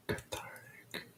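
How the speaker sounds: slow whisper into one side of the mic; ASMR whisper. Shown as a demonstration. Doing ASMR for the viewers into one side, whispered. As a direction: hush it slowly toward one ear. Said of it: "if it's the right or to the left".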